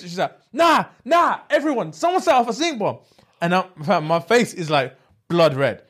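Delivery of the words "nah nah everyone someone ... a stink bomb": high-pitched cry of alarm; imitating a shrieking classmate; retold screaming. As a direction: warn the whole room in outrage. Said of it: "she screams".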